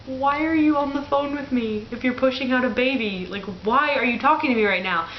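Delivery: mock-baffled dad, half shouting; incredulous dad. Dad's retold incredulous reply.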